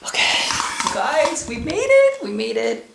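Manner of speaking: very high squeal, easing on the repeat; excited squeal. Real, not acted. Traveler telling viewers they made it, squealing with excitement. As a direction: celebrate in a giddy high squeal.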